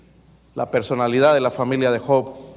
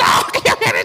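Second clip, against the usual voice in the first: much higher screeching tantrum; child shrieking; 6 dB louder, slower than usual. Retold or imitated. Child throwing a tantrum at the store, screeching. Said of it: "te pone a chillar".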